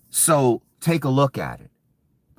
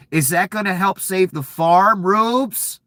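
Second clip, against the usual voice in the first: mocking taunt; 7 semitones higher than usual. Real, not acted. Taunting voters he calls rubes.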